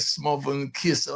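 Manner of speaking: usual speaking voice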